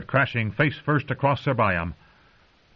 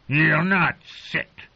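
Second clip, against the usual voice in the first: furious wildling; 5 semitones higher than usual. Acted. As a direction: throw them out of his hall in rage. Craster furious at guests.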